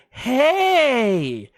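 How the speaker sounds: high, loud, drawn-out imitation; mock insistent call. Woman tapping his shoulder, loud high mock call. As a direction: hail a stranger high and long.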